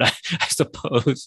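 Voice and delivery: slow, amused aside through a laugh. Real, not acted. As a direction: let a laugh carry the words. Wry aside about being proud.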